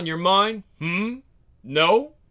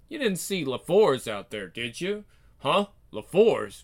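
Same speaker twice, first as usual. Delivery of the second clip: ordinary talk, nothing marked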